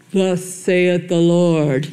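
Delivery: very slow, booming mock voice of God; mock-solemn, drawn-out divine proclamation; Hollywood God voice. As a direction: ham up a movie God from the clouds. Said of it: "Unlike Hollywood's version of God talking".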